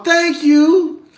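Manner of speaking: mama's high, over-polite thank you. Retold or imitated. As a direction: thank her overly politely. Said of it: "My mama".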